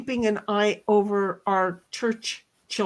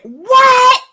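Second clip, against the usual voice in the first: very high, very loud shouted what; 13 semitones higher, 13 dB louder than usual. Retold or imitated. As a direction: belt it out in shock. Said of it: "I have a good loud voice".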